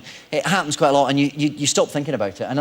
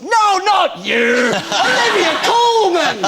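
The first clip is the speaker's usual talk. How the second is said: high, loud, drawn-out rebuff; mock indignant shout; 6 dB louder, much slower than usual. Old man correcting the wrong actor, hammed-up high shout. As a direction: imitate an old fan bellowing slowly past you.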